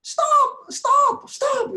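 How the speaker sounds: very high, loud scream of stop, near crying; child screaming. Retold or imitated. A child screams at fighting parents, loud and near tears. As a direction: shriek it like a scared kid. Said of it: "Anak saya tuh bisa teriak".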